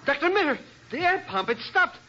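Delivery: high frantic calling out; panicked cry. Acted. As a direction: panic as the air pump dies. Air pump stopped, diver trapped.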